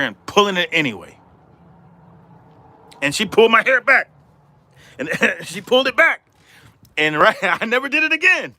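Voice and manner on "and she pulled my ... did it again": high, laughing through the punchline; amused retelling with laughter. Real, not acted. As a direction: chuckle it out, pitch up.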